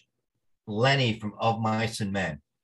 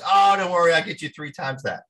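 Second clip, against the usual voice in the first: higher, louder bragging agent; hammed-up confident agent, louder; 8 semitones higher, 6 dB louder than usual. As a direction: boast like a big-shot agent. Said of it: "He goes".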